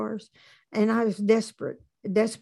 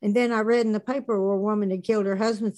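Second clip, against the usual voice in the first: ordinary talk, nothing marked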